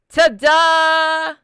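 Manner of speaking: loud, high, drawn-out fanfare; triumphant hammed-up cheer; big reveal. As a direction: sing it out like a showman. Boss reveals the surprise.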